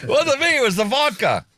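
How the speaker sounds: high, sing-song Wasn't me; mock denial. Host joking that vodka sent the text, high sing-song denial. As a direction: pass the blame in a high childish sing-song.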